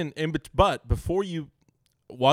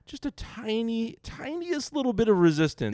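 mocking tiny; 10 semitones higher than usual. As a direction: belittle the tiny resistance. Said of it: "mocking tiny resistance".

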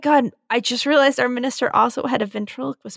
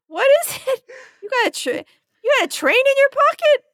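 put-on whimpering child; 13 semitones higher than usual. Scared kid asking.

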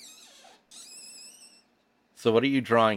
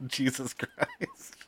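slow, breathless with disbelief; stunned laugh; 4 semitones higher, 6 dB quieter, much slower than usual. Real, not acted. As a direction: groan it out through laughter. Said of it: "It's looking like a peen".

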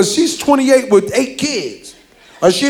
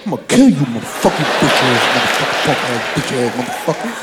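low muttered string of threats; kid threatening through peephole; 9 semitones lower, faster than usual. Retold or imitated. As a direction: growl curses low through the door. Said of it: "you be at the door like this".

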